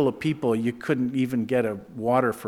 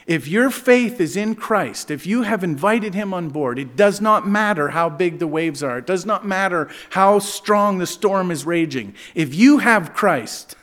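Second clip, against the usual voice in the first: preaching at full force; 6 semitones higher, 5 dB louder than usual. Acted.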